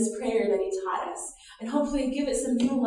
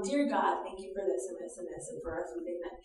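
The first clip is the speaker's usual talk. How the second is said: a light imitation of a child rattling off grace, fast and much softer; mock child's quick dinner prayer, hushed; lightly voiced child praying by rote; 4 semitones lower, 10 dB quieter than usual. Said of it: "if we're teaching a child to pray".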